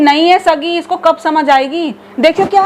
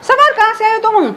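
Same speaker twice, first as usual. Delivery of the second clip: higher-pitched, startled questions; surprised; 6 semitones higher, slower than usual. Acted. Mother-in-law to Samar just back from captivity, surprised. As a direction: ask in surprise, pitch lifted. Missing man suddenly turns up.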